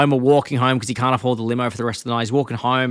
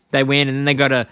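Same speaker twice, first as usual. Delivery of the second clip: ordinary talk, nothing marked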